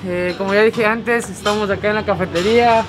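muffled behind cloth mask; speaking with covered mouth; through a face mask. Real, not acted.

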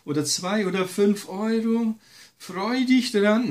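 high, coaxing tempter voice; sweet-talking devil urging him to keep the money; devil's voice. Retold or imitated. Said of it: "dann kommt schon der Teufel und sagt".

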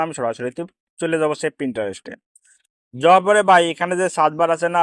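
normal talking voice

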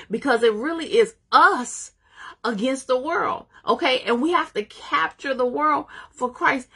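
preacher urging the church to link arms; preaching. Real, not acted. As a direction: build the call to capture the world. Preaching rising to full force.

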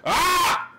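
loud, high scream; sudden shriek cutting off the sentence; startled scream. Real, not acted. Startled while cutting wood.

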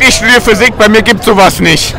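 ordinary delivery

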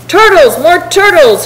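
high-pitched storybook bellow; bellowing and braying. A narrator's reading. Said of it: "he bellowed and brayed".

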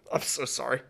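soft laugh through the sorry; laughing apology. Real, not acted. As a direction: apologize while laughing softly. Embarrassed apology after fumbling name.

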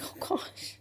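barely audible retold whisper; whispered dismay. Retelling her reaction to James's kindness, whispered dismay. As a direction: whisper it as if mortified.

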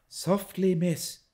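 checking servant. A narrator's reading. Servant woman checks the girl.

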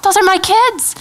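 high-pitched, pleading insistence; insistent protest. Retold or imitated. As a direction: claim them fiercely as a mother. Acted protest to God over her kids.

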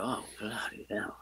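breathless whisper; whispered oath. Retold or imitated. Hearing [name] died, a whispered curse. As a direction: whisper it in shock. Reacting to the death news.

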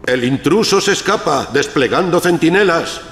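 loudspeaker alarm announcement. Acted. Alarm announcement through speaker.

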